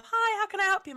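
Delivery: high, chirpy customer service greeting; sing-song polite waitress voice; bright customer voice. Retold or imitated. Waitress greeting a customer in a high chirpy service voice. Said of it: "turned into less of a".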